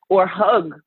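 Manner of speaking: ordinary delivery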